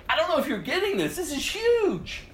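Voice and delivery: wide-eyed urgency; excited emphasis. Real, not acted. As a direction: push it up, amazed. Preacher stressing the point.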